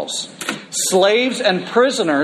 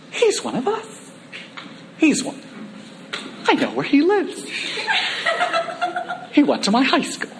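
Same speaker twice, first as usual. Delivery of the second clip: high, delighted hometown crowd voice; proud neighbours gushing, with laughs; excited townsfolk; 11 semitones higher, 5 dB quieter than usual. Acted. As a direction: voice them as thrilled locals claiming him. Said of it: "they're not being some, like, cranky old woman".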